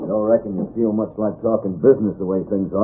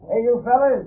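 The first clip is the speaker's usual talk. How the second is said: calling out; 10 semitones higher than usual. Acted. Calling passersby in from outside.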